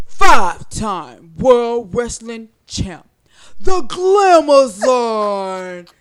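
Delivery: slow, booming ring announcer drawl; hammed-up Glamazon entrance, loud and slow; wrestling champ intro. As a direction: stretch it out like a wrestler introducing herself. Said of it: "my line from you".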